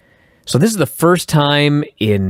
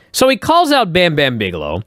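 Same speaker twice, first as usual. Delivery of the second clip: ordinary talk, nothing marked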